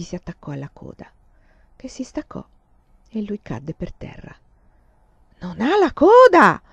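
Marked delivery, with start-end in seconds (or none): 5.4-6.6 high, loud, slow accusing yell; shout. A narrator's reading. Boy shouting to the guards about the queue, loud yell. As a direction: scream it to the guards. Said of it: "urlò, agitando la treccia".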